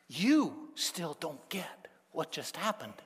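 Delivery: hushed, slow disbelief; slow incredulous whisper; exasperated whisper. Acted. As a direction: whisper it like you can't believe they missed it.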